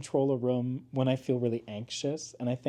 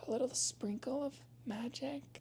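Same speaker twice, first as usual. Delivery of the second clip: whimsical; 11 semitones higher, 12 dB quieter than usual. Acted. Whimsical put-on voice.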